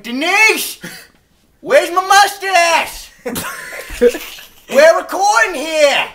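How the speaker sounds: high, loud furious yelling; angry shout. Acted. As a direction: yell at the crew in a rage. Anchor missing his mustache.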